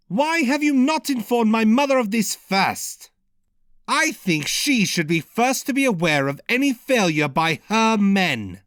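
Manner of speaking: irritable growl. A narrator's reading. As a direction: snap at them with irritation. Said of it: "responded in an irritable growl".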